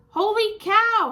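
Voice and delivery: big amazed holler. A narrator's reading. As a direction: holler it across the diner. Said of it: "hollered Manny the cook".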